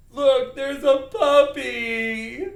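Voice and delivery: loud, high, slow wail; mock sad. Shown as a demonstration. Teacher demonstrating sadness at seeing a puppy, sad. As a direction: drag it out in a hammed-up sob. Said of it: "we are going to be sad".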